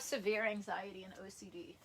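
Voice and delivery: whispered, unvoiced diagnosis; hushed whisper of a therapist's verdict. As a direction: breathe it like a secret. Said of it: "he was just like".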